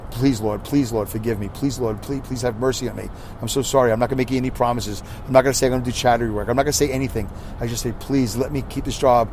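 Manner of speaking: soft, pleading prayer to God; whispered plea to the Lord, asking to keep the job; hushed desperate prayer. Retold or imitated. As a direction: beg quietly for mercy. Prayer begging for forgiveness.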